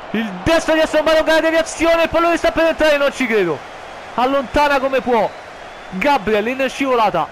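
high, loud shouted call; breathless italian goal commentary. Acted. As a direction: yell as the ball nearly goes in.

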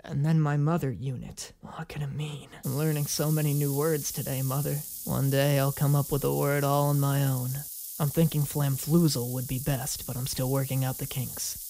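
low, soft android learning human words; hushed, deep monotone character voice; Gaara. Acted. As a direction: speak low and quiet, like a robot. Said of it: "I don't know why I gave him Gaara's voice".

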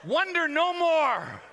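loud, high comic wail; scalded outcry. Acted. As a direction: holler as if hit by hot water. Acts out shock of hot water.

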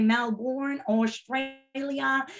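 mock American accent, drawn-out Australia; terrible American accent attempt. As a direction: badly imitate how Americans say it. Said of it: "that is my terrible accent or attempt at an accent".

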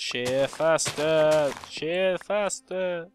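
slow, stretched-out command; mock urging. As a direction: drag out each word, pushing for speed. Urging on the shears.

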